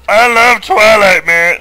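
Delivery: loud, high goofy dude. Pretend. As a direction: shout it like a proud bro. Reading Mario's joke tattoo aloud.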